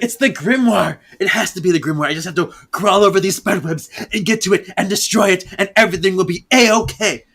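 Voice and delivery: frantic Prue. Acted. Prue's frantic plan, acted quote.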